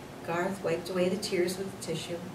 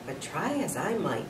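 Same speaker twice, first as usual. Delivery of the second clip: plain reading voice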